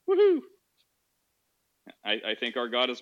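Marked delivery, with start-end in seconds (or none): 0.1-0.4 high, loud woohoo; mock woohoo. Streamer caps a tongue-in-cheek reply with a high woohoo. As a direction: let out a high mock cheer.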